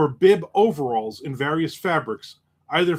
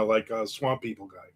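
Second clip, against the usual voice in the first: ordinary talk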